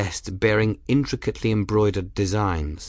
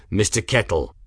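hard tone. A narrator's reading. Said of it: "his tone hard".

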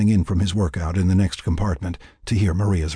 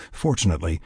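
ordinary delivery, nothing marked

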